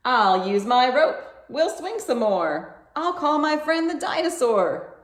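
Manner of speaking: eager rescuers. A narrator's reading. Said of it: "The others spring to his defense".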